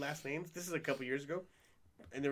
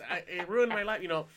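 ordinary talk, nothing marked

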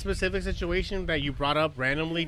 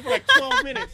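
exasperated exaggeration; 8 dB louder than usual. Real, not acted. Exaggerating mom's hug length.

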